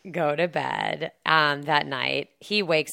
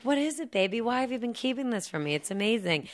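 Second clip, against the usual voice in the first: gushing wife; 7 semitones higher, faster than usual. Retold or imitated. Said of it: "And she's like".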